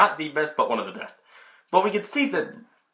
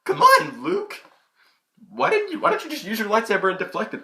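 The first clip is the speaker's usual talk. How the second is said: exasperated disbelief; 11 semitones higher than usual. Retold or imitated. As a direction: yell at the screen in disbelief. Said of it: "I'm like".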